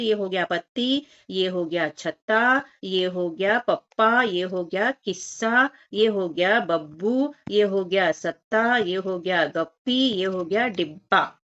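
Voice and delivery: put-on slow, drawn-out word building for children; teacher slowly sounding out joined letters; slow blending demo. Shown as a demonstration. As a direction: stretch each word out as you blend it. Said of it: "मिला के लिखना है और पढ़ना है".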